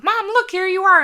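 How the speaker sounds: put-on high, bright kid voice; kids excited. Mom voicing her kids spotting her video, excited high call. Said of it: "they're like".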